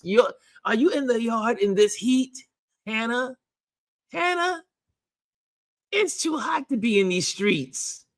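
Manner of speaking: high, teasing mock scold; high playful telling-off. Real, not acted. Teasing a viewer out in heat.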